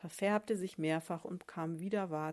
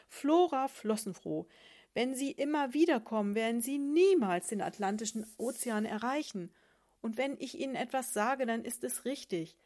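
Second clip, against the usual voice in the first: higher, louder lofty lecture; pompous sponge; 4 semitones higher, 6 dB louder than usual. Acted. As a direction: dismiss her with grand self-importance. Sponge dismissing the sardine.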